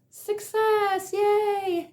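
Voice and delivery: high, squealed cheer on success, yay; a sudden little mock cheer of triumph. Cheering herself to the viewers, high and excited. Mock celebration of her weekend.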